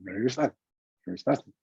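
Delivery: phrase gabbled super fast; light speed. Shown as a demonstration. Coach demonstrates the phrase sped up, gabbled at light speed. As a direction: rattle it off in a quick blur. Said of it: "What if you played it at, like, light speed?".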